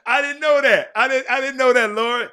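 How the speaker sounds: loud, high exclaim through laughter; surprised laugh. Real, not acted. Host laughing in surprise at Lori's story, loud and high. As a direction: caught off guard, laughing it out.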